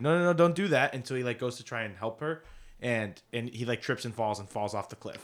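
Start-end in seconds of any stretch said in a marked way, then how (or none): 0.0-0.9 higher and louder, a retold panicky plea; mock panic. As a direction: playfully imitate a frightened friend shouting a warning. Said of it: "freaks out and is like".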